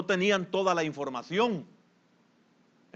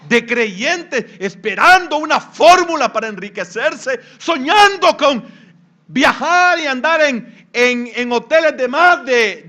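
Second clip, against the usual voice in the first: loud, high preaching that slows on key phrases; loud sermon climax; 8 semitones higher, 11 dB louder than usual. Acted. Pastor denouncing believers who dream of riches, at full force. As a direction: rail against greedy believers.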